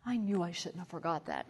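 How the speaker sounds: low, hushed mock regret; sheepish admission. Son admits he forgot, retold.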